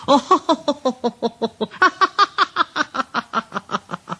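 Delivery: high long mocking laugh; high teasing cackle; engine laughter. A narrator's reading. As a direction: laugh at the trucks like a cheeky engine. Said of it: "the two engines laughed".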